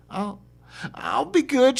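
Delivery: high, timid woman's voice; lightly voiced meek woman. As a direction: promise meekly. Woman meekly promises.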